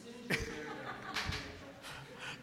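quiet airy laugh into the mic; breathy laugh. Real, not acted. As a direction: let out a soft breathy chuckle. Laughing at own story.

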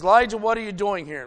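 loud, fast divine demand; God's voice demanding an answer from Elijah; booming challenge. Retold or imitated. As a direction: call him out loudly and quickly. God confronts the distraught prophet.

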